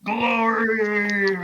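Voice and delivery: high, loud, drawn-out war cry; battle yell. Pretend. Player's warrior charges into the fight, yelling. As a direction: bellow it long and high. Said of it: "And I'll just yell".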